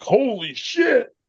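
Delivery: high, drawn-out astonished exclamation; amazed reaction. Retold or imitated. Viewer watching the hit in real time, amazed reaction. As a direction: re-enact being blown away, pitch way up.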